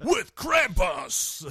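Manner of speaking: slow, high, loud booming monster voice; booming alien overlord. Acted. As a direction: drag the words out like a menacing demon. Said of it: "when you do that voice".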